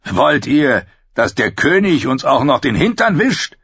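loud, high indignant outburst; angry question. A narrator's reading. Cotter Pike scorns Janos' proposal, angry and loud. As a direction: throw it at them in disgust. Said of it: "fragte Cotter Pike wütend".

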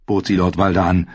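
ordinary delivery, nothing marked

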